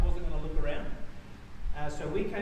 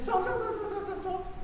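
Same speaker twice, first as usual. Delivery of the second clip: high, urgent repeated stop, mock frantic; a mother's panicked call to stop, retold; wife yelling at the rider to stop, retold urgent call; frantic stop cry; 14 semitones higher, 3 dB quieter than usual. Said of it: "Catalina heard the scream, said".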